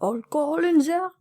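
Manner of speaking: put-on a sly Frenchman leaning in, French accent; French accent whisper. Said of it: "whispers in a French accent".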